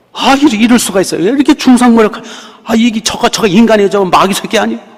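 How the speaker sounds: high, loud indignant protest; outraged complaint. Retold or imitated. Preacher reenacting her indignant complaint to the Lord, high and loud. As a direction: vent the outrage to God.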